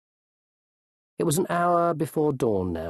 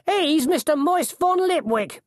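very high, raised voice; announcing coachman; 4 dB louder than usual. A narrator's reading. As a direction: call out an important man's name.

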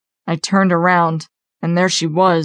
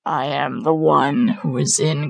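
slow, low demon; ominous, drawn-out creature voice; hideous guttural voice; 3 semitones lower, 3 dB quieter, slower than usual. A narrator's reading. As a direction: declare it slowly as a guttural monster. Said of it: "now in a hideous guttural voice".

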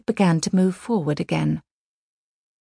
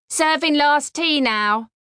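a train attendant's sing-song call, higher and slow; 6 semitones higher than usual. A narrator's reading. Attendant calling down the train corridor, slow call. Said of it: "uttering his monotonous cry".

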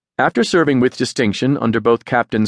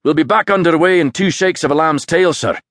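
higher Scottish engineer brogue; Aberdeen accent; 6 semitones higher than usual. A narrator's reading. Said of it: "His robust Aberdeen accent".

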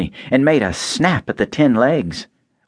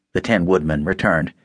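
usual speaking voice

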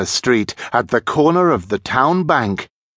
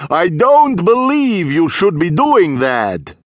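mysterious, theatrical vampire voice, pitched up; raised, ringing voice from the shadows; Count Dracula; 8 semitones higher, 4 dB louder than usual. A narrator's reading. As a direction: warn them with grand composure. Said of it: "a mysterious voice echoed round the cellar".

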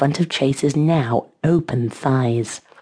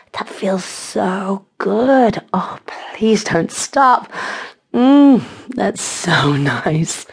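slow, drawn-out, higher pleading; breathless pleasure; 4 semitones higher, much slower than usual. A narrator's reading. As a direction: stretch the words out in acted delight. Character voiced mid-pleasure after gasping.

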